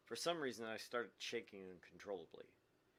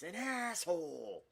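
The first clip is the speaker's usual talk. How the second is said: mock heckle; 9 semitones higher, 8 dB louder, much slower than usual. Real, not acted. Heckling the girl's monologue.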